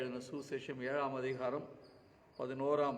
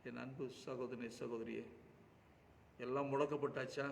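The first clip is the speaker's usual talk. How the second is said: normal talking voice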